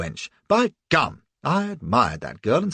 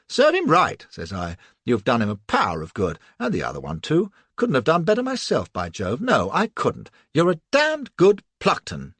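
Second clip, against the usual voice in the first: hearty praise; 4 dB louder than usual. Acted. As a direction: boom out the praise like an old soldier, louder. Narrator heartily praising Cassie.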